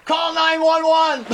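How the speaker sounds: urgent shout for help. Acted. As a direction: yell it out, urgent. Child face down in pool.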